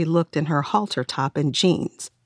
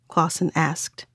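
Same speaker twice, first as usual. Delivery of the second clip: usual speaking voice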